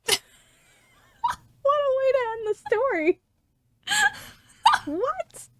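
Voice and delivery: high, drawn-out words through laughter; laughing in disbelief. Real, not acted. Reader reacting to the story's abrupt ending, laughing in disbelief. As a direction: squeal it out while laughing.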